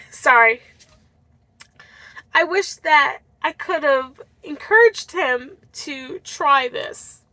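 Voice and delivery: real tears held back, voice catching; fighting not to cry over her late father-in-law; holding back tears. Real, not acted. Tearful, remembering her father-in-law she couldn't help. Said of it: "sorry, I'm trying not to cry".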